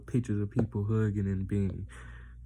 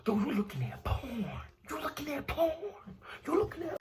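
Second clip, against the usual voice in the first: imitated excited whisper, pitched way up; talking low; 13 semitones higher, 8 dB quieter than usual. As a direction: squeal it under your breath. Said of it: "talking low".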